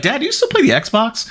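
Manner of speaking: put-on a high, curious kid voice; lightly voiced young son asking his dad; imitating his son. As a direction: ask it the way a little boy would. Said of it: "he's like".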